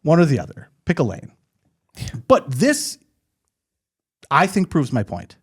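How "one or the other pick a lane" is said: normal talking voice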